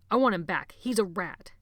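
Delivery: loud, hard demand; angry demand. Acted. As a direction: push him angrily. Sebastian demanding angrily.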